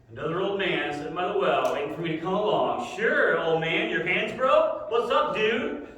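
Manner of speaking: put-on loud, sarcastic eye-rolling teen; teenage angst. Sulky teen girl mocking the old man at the well. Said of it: "you can feel that teenage angst".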